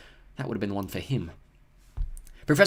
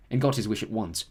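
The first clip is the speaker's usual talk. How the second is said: ordinary delivery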